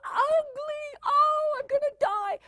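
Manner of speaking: very high, drawn-out mock wail; slow, melodramatic teen howl; dramatic teenager. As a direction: ham up a teenager's despair. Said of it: "you look at them and they go".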